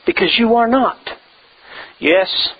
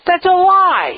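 emphatic denial; 8 semitones higher than usual. Real, not acted. Preacher rejecting a false belief.

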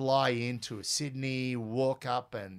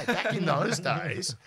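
ordinary delivery

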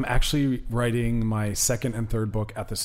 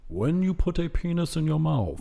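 mock British audiobook narrator; lightly put-on British accent, reading aloud; British actor impression. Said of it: "there's this British actor reading it".

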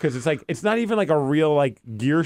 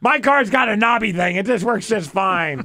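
higher-pitched griping, acted out; retold exasperated protest; 5 semitones higher, 3 dB louder than usual. Husband confused by the new car's windows, exasperated. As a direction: whine in defense of the simple old knob.

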